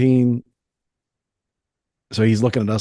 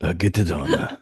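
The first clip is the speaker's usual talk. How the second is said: put-on Japanese accent. Continues the put-on accent bit.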